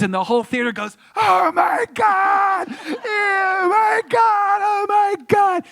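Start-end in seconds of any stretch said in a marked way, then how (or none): 1.1-5.6 very high drawn-out mock screams; screaming audience. Horrified audience as the gremlin explodes, shrieking screams. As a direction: imitate a whole theater shrieking. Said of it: "People were screaming. It was so loud".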